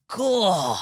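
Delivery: high, straining gasp of effort; strained exclamation. Retold or imitated. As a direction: grunt it out as you lift. Mate straining to lift.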